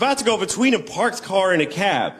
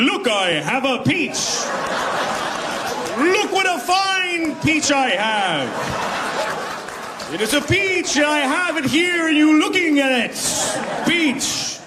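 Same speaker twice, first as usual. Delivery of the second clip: crazy peach man; 10 semitones higher, slower than usual. Retold or imitated. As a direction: slowly announce the peach like a madman. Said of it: "I just started going".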